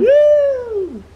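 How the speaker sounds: loud, high whoop; excited whoop. Real, not acted. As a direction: whoop while swinging. Shout on rope swing.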